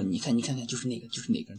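hushed gossip. Retold or imitated. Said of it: "然后那个女生就说".

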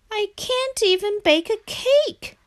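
high-pitched, plaintive frog voice; sad. A narrator's reading. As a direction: lament in a high little frog voice. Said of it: "thought Frog sadly".

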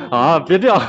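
laughing through the words; embarrassed laughing. Real, not acted. Laughs after singing.

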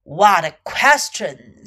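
low, loud, slow lion voice; dismissive lion. A narrator's reading. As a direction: drop deep and dismiss it slowly. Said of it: "said Lion".

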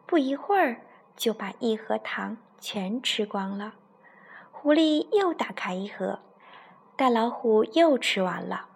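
put-on storytelling voice for a child; story narration. A narrator's reading. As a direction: read it like a bedtime story to a little one. Mother reads bedtime story. (给孩子讲故事的语气)

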